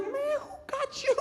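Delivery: high, soft, drawn-out panicky squeal; acted high-pitched disbelief; freaking out. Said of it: "I'm still freaking out".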